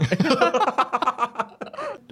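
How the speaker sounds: high, loud delighted laugh; loud burst of laughter. Real, not acted.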